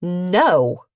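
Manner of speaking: sharp indignant no. A narrator's reading. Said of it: "She added a sharp".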